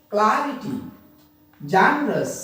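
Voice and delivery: "Clarity" as CLA-ri-ty, "generous" as JE-ne-rous, falling away; first-syllable stress. Shown as a demonstration. Teacher showing how to read both words, first syllables stressed. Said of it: "like this".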